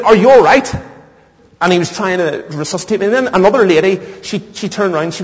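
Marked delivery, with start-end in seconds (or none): 0.0-0.7 high, loud check on someone who fainted; concerned stranger, imitated. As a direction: call out to rouse him. Stranger rousing a fainted passenger.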